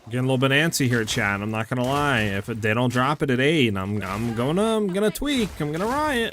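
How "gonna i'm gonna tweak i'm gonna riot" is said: high-pitched frustrated venting; worked-up outburst. Real, not acted. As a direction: pitch jumps way up in exasperation. Fed-up streamer waiting for patch.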